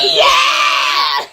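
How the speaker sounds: high, loud victory yell; triumphant shout. Real, not acted. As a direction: cheer it like you won. Triumphant guess was right.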